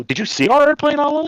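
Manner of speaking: very high-pitched disbelief; retold incredulous outburst. Man retelling the scary landing to his wife, incredulous. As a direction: re-enact it, voice shooting way up.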